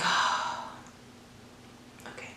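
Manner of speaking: a near-silent unvoiced "oh, okay"; breathy whispered okay. Narrator hearing her friend's threat, whispering uneasy okay. Quiet unsure reply.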